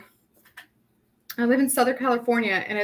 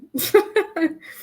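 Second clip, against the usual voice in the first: laugh; 10 semitones higher, 4 dB louder than usual. Real, not acted. Laughing at a viewer's comment.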